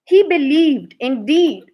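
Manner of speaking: ordinary delivery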